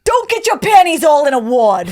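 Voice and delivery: Boston accent on request, pushed harder. Shown as a demonstration. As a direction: hammer the Boston vowels. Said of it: "Say that again in that accent again".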